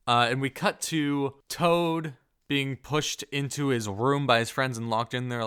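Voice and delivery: ordinary talk, nothing marked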